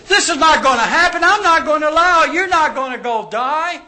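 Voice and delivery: high, loud, rushed protest; outraged refusal. Acted. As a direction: shout it down, flatly forbidding it. Peter's outraged refusal, acted.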